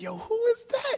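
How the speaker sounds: high, sharp hey-who's-that call; mock high call-out. Teen boy spotting a girl at the rink, high call. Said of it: "And you'd be like".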